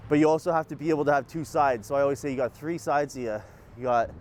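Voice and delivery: ordinary talk, nothing marked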